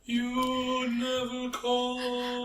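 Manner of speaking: high, long-drawn ghostly wail; haunting ghost. Acted. Joining co-host's bit as the haunting ex, mock ghost wail. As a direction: stretch every word out like a spook. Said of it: "she's the ghost that's haunting you".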